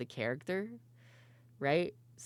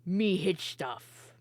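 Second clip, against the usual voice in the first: slow, higher-pitched orc voice; dim orc; 4 semitones higher, much slower than usual. Acted. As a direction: drag out each word like a slow-witted orc. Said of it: "had very, very poor language skills".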